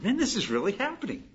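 high, quick, giddy disbelief; growing excitement. Acted. Homeowner watching a stranger pay off the mortgage, growing excitement. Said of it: "you get a little more excited".

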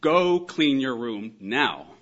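loud, slow, drawn-out order; parental command. Acted. Parent ordering a child to the bedroom, loud slow command. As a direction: give it as a firm imperative. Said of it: "You're actually saying".

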